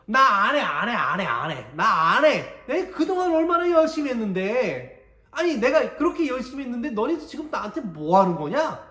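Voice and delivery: loud, high frustrated refusal; fed-up outburst. Pretend. Preacher imagining himself as Moses quitting, loud and frustrated. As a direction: throw a tantrum, quitting it all. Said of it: "만약에 제가 모세였으면 이렇게 했을 거야".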